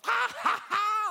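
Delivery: put-on high, loud delighted kid laughter; kid's laugh. Rescued boy laughing his face off, high and gleeful. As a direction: cackle with pure glee.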